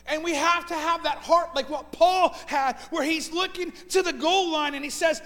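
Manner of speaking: fervent sermon delivery, pressing the point; preaching at full force. Acted. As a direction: preach it out to the whole church. Preaching to the congregation.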